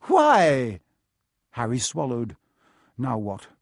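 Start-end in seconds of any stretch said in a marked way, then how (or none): 0.0-0.8 sharp, louder, higher single word; stern, probing teacher; suspicious challenge. A narrator's reading. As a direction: demand a reason. Professor challenges a suspicious request.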